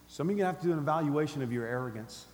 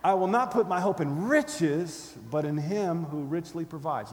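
loud, slow punch on "riches", easing off after; defiant declaration settling into assurance; with attitude; 4 dB louder, slower than usual. Shown as a demonstration. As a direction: hit "riches" hard with scorn. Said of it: "try it again with the attitude".

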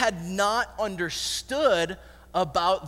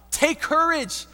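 high, raised call from a distance; bold, lifted reassurance; calling out encouragement; 7 semitones higher, slower than usual. Acted. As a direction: call courage across to the boat. Jesus calling from away from boat.

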